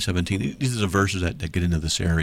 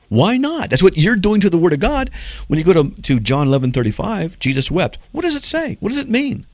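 much higher and louder, fired-up preaching; heated rebuttal; 11 semitones higher, 6 dB louder than usual. Real, not acted. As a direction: hammer each question home at the critics. Heated rebuttal to Bible critics.

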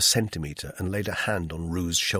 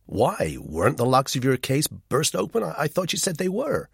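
puzzled; 7 semitones higher than usual. A narrator's reading. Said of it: "He looked puzzled".

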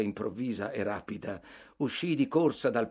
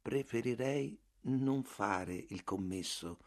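deciso, definitivo; 7 dB quieter, slower than usual. Acted. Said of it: "come per risolvere la questione".

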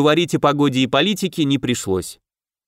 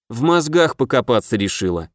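ordinary delivery

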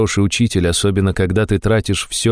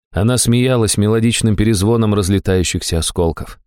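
ordinary delivery

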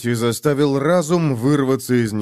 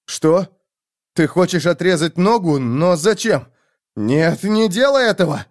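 alarmed pleading; 6 semitones higher, 3 dB louder than usual. A narrator's reading. Man fears leg will be cut.

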